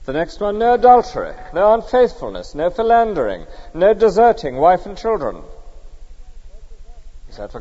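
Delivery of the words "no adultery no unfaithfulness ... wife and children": emphatic commandments. Real, not acted. Preacher reciting the commandments aloud.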